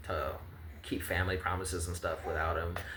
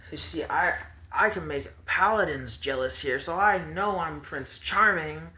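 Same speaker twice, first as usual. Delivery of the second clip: much higher, preening show-off voice; high vain boast; 7 semitones higher than usual. Acted. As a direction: brag like a vain prince. Promised child boasting of his looks.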